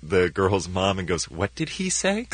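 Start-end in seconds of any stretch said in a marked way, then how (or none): 1.3-2.3 high-pitched imitation through a venomous smile; Melissa McCarthy, venomous sweetness. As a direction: mimic a menacingly smiling question, pitched high. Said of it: "that sort of venomous smile".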